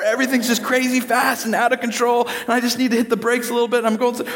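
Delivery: high-pitched mock panic; sustained high voice, overwhelmed by speed. Preacher plays old-car driver overwhelmed by speed, high panic. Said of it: "everything was just super fast".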